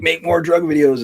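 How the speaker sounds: high, loud mock demand from the fans; demanding viewers.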